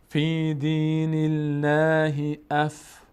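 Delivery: slow chanted phrase, light L in "الله"; thin light Allah. Shown as a demonstration. Teacher reciting the verse, light drawn-out L. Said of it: "باریک".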